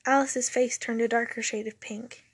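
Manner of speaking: ordinary delivery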